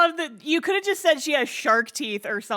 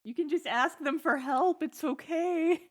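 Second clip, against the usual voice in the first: tender reassurance; 4 dB quieter than usual. Retold or imitated. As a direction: comfort her like a scared child. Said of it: "It's like".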